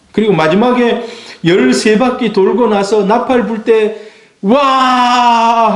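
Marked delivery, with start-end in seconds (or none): 4.4-5.8 shout of wa. Shown as a demonstration. Pastor shows the Jericho shout to the congregation, high ringing cry. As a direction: cry out like the army at the walls. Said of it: "하고 소리치는 거예요".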